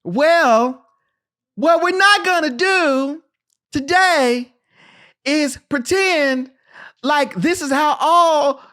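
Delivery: high, loud, slow high-ranking officer, breaking into laughs; Kerry Washington impression. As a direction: proclaim every phrase slowly as a commanding captain. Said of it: "do it in the Kerry Washington as a".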